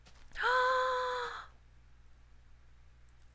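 sharp high gasp; shocked gasp. Real, not acted. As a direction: gasp in sudden alarm. No fish, high alarm.

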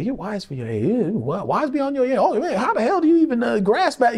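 put-on an older man marveling; impressed father. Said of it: "I always say".

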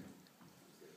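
barely audible, breathy whisper; whispered aside. Real, not acted. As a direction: drop it to a whisper. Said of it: "repeated quieter".

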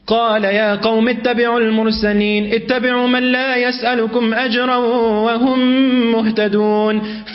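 quick chanted verse; Quran recitation. Acted. Preacher quotes Surah Yasin to the congregation, chanted. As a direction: recite it in rapid cantillation.

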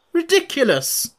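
very high, loud outburst; indignant cry. A narrator's reading. Red Queen cutting off Alice's argument, crying out. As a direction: cry it out sharply, pitched right up. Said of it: "cried the Queen".

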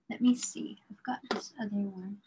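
quick whisper to herself; whispered aside. Real, not acted. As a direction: mutter it under your breath, fast. Muttering to self while drawing.

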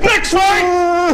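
very high, loud mock announcer shout; announcer yell. Host calling the next bout, hammed-up shout. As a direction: holler it out, drawn long.

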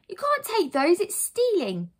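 put-on high, loud boy's objection; indignant protest. Boy objects to keys being taken, indignant protest. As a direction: accuse her like an outraged child.

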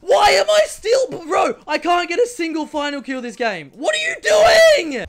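high, loud, half-crying yells; frustrated shout. Real, not acted. Player scammed of kills again, frustrated shouting. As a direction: wail at the screen in frustration.

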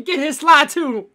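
high, loud, drawn-out holler; hammed-up mob yell; angry mob member. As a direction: bellow it slowly to the posse. Plays mob chasing the imposter.

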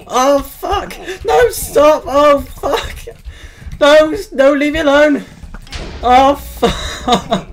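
very high, loud screaming; terrified shrieks. Real, not acted. Gamer being shot at, screaming very high and loud. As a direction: shriek in real terror. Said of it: "I'm completely terrified".

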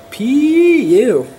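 loud high disgusted groan. Acted. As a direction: yell it like something stinks. Reacting to stinky lizard.

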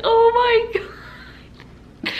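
squealing, drawn-out laugh on the words; laughing hard. Real, not acted. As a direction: wail it through helpless high-pitched laughter. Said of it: "I wasn't laughing".

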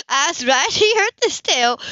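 ordinary delivery

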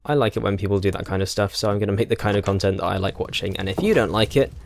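ordinary delivery

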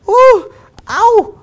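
very high, loud yelps of pain; mimicked shrill "ooh! ow!" cries; imitated high-pitched yelp. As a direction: yelp high like a spanked boy. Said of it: "gritaba en voz alta".

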